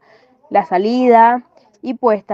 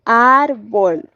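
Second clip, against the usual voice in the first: "Árbol" with the AR drawn out; "Árbol" as a careful stress demo, AR prominent; stress on the first syllable. Shown as a demonstration. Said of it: "esta marca la posición del acento".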